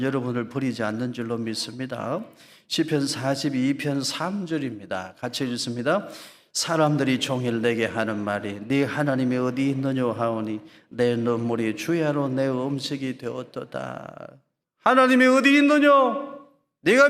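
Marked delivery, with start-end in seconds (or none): 2.7-6.1 scripture announcement. Acted. 6.5-14.4 loud, measured scripture reading; psalm reading. Acted. Preacher leading congregation in psalm, loud reading. Reads psalm aloud together.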